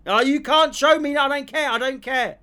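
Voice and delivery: high, loud mocking whine of a dismissive bloke. Mimicking the promoter who won't look at the phone, loud mocking whine. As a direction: sneer it like a petulant promoter.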